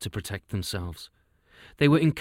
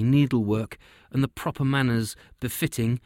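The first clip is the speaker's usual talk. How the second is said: plain reading voice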